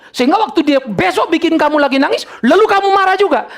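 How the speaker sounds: high, insistent preacher's warning; impassioned preaching. Real, not acted. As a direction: drive each point home from the pulpit. Preaches warning at full force.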